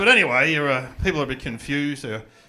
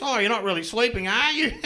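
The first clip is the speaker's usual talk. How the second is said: lightly voiced doctor, rising high at the end; a hint of a teasing doctor catching him awake; 5 semitones higher than usual. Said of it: "the doctor wanders in like this".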